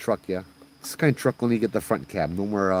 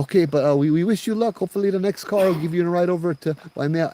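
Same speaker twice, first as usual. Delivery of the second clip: high, louder well-wishing; polite brush-off; 9 semitones higher, 4 dB louder than usual. Acted. Driver wishing auntie luck to avoid taking her, high and polite. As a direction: wriggle out politely in a raised voice. Driver tries to decline lady.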